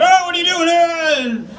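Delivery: high, slow mock cop challenge; aggressive cop. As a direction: bark it like a pushy cop. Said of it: "like hyper aggressive".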